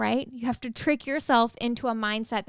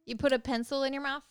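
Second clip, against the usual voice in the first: ordinary talk, nothing marked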